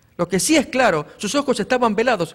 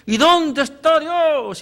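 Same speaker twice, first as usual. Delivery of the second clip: despairing cry; 7 semitones higher, 5 dB louder, slower than usual. Retold or imitated. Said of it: "y hacemos expresiones como esas".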